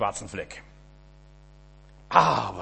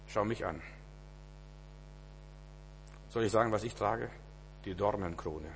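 softer, lower, close to the listener; hushed intimate preaching; 3 semitones lower, 10 dB quieter than usual. Acted. Preacher to congregation, hushed intimate sermon. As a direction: draw them in quietly.

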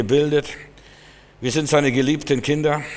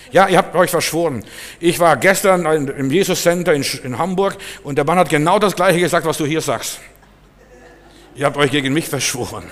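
angry accusation; 3 semitones higher, 5 dB louder than usual. Retold or imitated. Young man insists to the preacher he's being conspired against, loud and agitated. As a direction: hammer home the conspiracy claim, louder. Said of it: "schimpft mich aus".

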